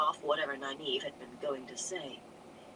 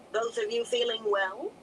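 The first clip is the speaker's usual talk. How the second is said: cheery; 6 semitones higher, 4 dB louder than usual. A narrator's reading. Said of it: "the Amyrlin said cheerily".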